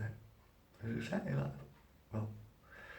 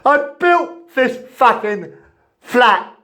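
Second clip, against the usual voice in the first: loud, much higher, slow raging yell; furious shout; 9 semitones higher, 12 dB louder, slower than usual. Real, not acted. Tenant raging at the housing company over his flat, furious shouting. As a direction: scream the grievance out at them. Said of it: "Sorry, people. Lost my temper".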